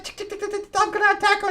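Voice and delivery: high-pitched chittering falsetto; silly bat voice. Retold or imitated. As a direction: chitter like attacking bats, hammed up. Voicing the hero turned bats.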